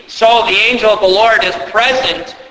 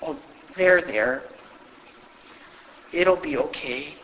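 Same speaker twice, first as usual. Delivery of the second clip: much softer, cooing there-there; soothing parent; 4 semitones lower, 11 dB quieter than usual. Retold or imitated. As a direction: hush them like a parent comforting a child. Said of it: "as the modern day parent goes".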